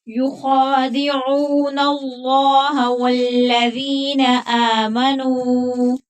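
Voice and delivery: slow, drawn-out melodic tajweed chant; Quran recitation. Acted. Teacher reciting the verse to students before explaining it, slow melodic chant. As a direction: recite slowly, stretching each long vowel.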